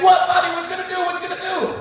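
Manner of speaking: high taunting hype man. Pretend.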